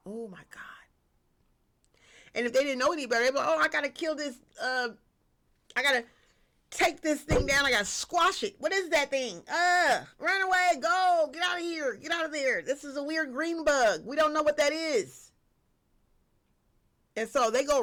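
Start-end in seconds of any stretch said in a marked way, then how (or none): 0.1-0.8 soft breathy whisper to herself; barely voiced, awed; whispered aside. Real, not acted. Whispered aside after slow reflection. 3.5-15.3 mock panic. Voices panicked bug killers.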